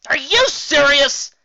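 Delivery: high, loud and slow disbelief; can't believe what she hears; incredulous. Acted. Raindrops in disbelief at Trixie, loud incredulous.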